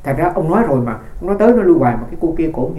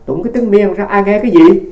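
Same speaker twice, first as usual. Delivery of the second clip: a louder, higher mock scoff at the chanting; scoffing father; 5 semitones higher, 7 dB louder than usual. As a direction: lightly voice the dismissive old father.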